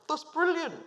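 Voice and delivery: put-on high, bright-eyed burst of enthusiasm, no accent; boss's delighted reply. Imitating boss Graham's thrilled reaction to her IVF news. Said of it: "he's got a Brummie accent".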